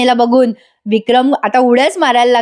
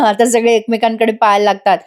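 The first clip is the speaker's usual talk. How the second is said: usual speaking voice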